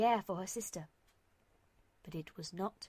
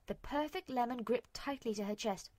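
plain reading voice

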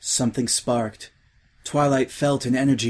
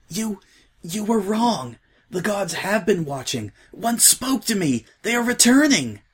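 high, urgent changeling zealot; breathless, rising to a cry; fevered Twilight; 9 semitones higher than usual. A narrator's reading.

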